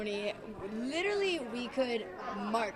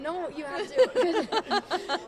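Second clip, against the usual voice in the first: insistent plea; 8 semitones higher, 5 dB louder, much slower than usual. Real, not acted. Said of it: "insisting partner watch video".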